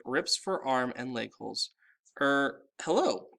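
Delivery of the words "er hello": slow, hesitant hello; a mild nervous greeting; unsure boy, caught off guard. A narrator's reading. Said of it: "said Mister D nervously".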